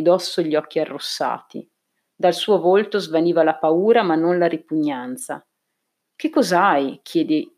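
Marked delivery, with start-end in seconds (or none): none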